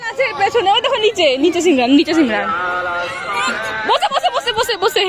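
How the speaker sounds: high, loud encouraging calls. Real, not acted. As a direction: egg the little ones on excitedly. Cheering children at playground. (উচ্ছ্বসিত হয়ে উৎসাহ দেওয়া)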